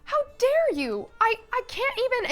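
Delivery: high-pitched, outraged indignation; imitating an incensed young woman, pitched high; mad. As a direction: sputter with acted fury. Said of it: "she was so mad".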